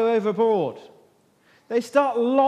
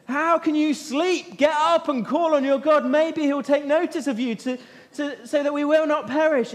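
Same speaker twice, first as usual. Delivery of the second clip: urgent captain; 7 semitones higher than usual. Pretend. Captain rousing Jonah during the storm, alarmed and pleading. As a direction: shake the sleeper awake with urgency.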